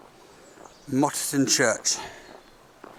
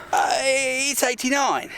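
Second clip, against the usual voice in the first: higher, slow, hesitant answer; shy interviewee; 9 semitones higher than usual. Pretend. Mock interview with himself.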